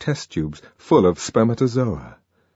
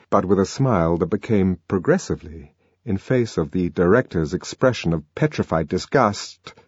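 ordinary delivery

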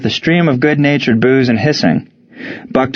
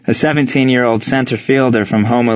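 ordinary delivery, nothing marked

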